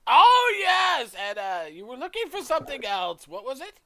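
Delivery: very high shout, easing as it goes; forgetful shopkeeper, suddenly remembering. Acted. Shopkeeper remembering the customer's order, high and loud. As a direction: burst out high and loud, then settle.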